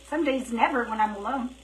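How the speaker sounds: ordinary talk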